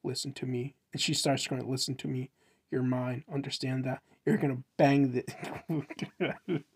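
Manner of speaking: low-key, softly spoken mock menace; commanding. Grabbing an arm to insist, quiet mock command. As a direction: order them quietly, with mock intensity. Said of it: "then he grabs him by the arm and says".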